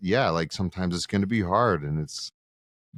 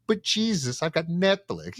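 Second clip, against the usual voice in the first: higher, whining protest; whiny excuse; 9 semitones higher than usual. Retold or imitated. To Jesus, skipping prayer for Netflix, a high whiny excuse. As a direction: plead like a kid dodging chores.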